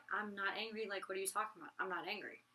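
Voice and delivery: calm denial. Retold or imitated. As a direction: say it softly, unbothered. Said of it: "you'd be like".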